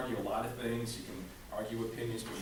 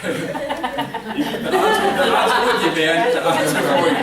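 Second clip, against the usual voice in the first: loud laughing call, much higher; laughing shout; 11 semitones higher, 17 dB louder, slower than usual. Real, not acted. Speaker calls out laughing to the late guy, loud.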